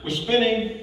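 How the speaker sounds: ordinary delivery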